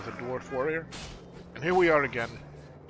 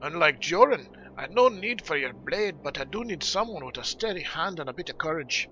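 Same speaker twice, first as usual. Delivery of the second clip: lively dwarf voice appealing for help; brisk dwarf leader recruiting a helper; earnest dwarf quest giver; 5 semitones higher, 4 dB louder than usual. Acted. Dwarf asking Hurgrim to help bandage the wounded, earnest.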